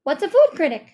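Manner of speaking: loud, high eager question; excited shout. A narrator's reading. Rishi asking what the new job is, excitedly. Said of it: "Rishi shouted excitedly".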